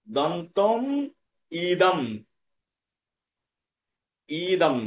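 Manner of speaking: দন্তম and ইদম said apart, long ই on ইদম; careful word-by-word দন্তম, ইদম; separated words. Shown as a demonstration. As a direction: isolate ইদম after a pause, clearly. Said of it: "ইদম শব্দটিকে এই".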